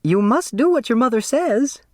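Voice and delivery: put-on high, prim voice of a timid older woman; timid Flora insisting. Flora urging Turtle to obey her mother, high prim insistence. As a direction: insist gently but earnestly. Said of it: "had insisted".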